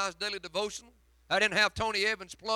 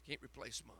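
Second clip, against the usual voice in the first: soft echo; 7 semitones lower, 21 dB quieter, faster than usual. Real, not acted. Repeated, fading softer.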